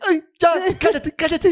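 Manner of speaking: high-pitched mocking wife voice. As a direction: imitate her whining at him to shut up. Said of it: "y ella dice".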